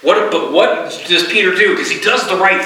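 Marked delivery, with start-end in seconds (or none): none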